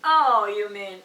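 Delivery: loud, high "oh, you mean milk"; loud sudden realization. Retold or imitated. Clerk suddenly understands her, loud and high. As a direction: burst out as the penny drops.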